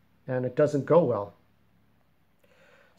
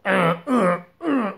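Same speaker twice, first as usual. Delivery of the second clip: higher, strained stammering sounds, lightly voiced; gravelly broken voice; 6 semitones higher, 3 dB louder, slower than usual. Shown as a demonstration. Creator shows scarred Jerry's struggling speech, lightly strained. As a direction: struggle to get the words out. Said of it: "his voice is gravelly".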